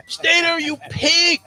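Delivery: high, loud bettor's yell; racetrack yell. Retold or imitated. Long shot player urging his horse on, yelling. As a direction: holler it at a horse out on the track.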